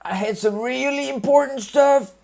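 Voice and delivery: high, loud put-on Trump voice; botched Trump imitation; Trump impression. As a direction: ham up a Donald Trump voice. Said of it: "I can't do a Trump impression".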